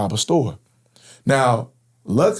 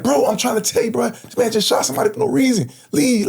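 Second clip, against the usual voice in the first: urgent plea; 12 semitones higher than usual. Retold or imitated. Pleading with driver to go.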